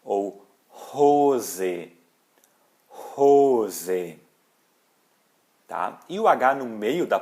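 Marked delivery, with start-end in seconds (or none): 0.7-4.2 Rose with a breathy aspirated H at the start; aspirated German H. Shown as a demonstration. Teacher showing students the aspirated H. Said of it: "vai ter um som aspirado".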